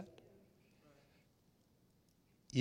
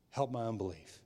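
softer, a low intimate plea; quiet plea; 3 semitones lower, 6 dB quieter than usual. Acted. A doubting father asking Jesus quietly, soft and intimate. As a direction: murmur it like a confession.

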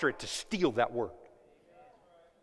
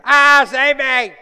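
loud, high, slow scream for help; yelling and screaming; 8 semitones higher, 15 dB louder, slower than usual. Acted. Preacher acting Peter yelling for help on stage. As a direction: scream it like a drowning man. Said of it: "he's yelling and screaming".

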